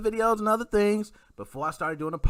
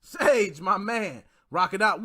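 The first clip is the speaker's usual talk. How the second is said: ordinary talk, nothing marked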